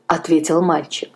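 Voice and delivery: ordinary delivery, nothing marked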